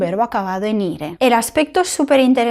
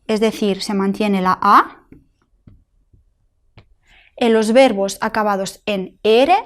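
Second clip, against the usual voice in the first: normal talking voice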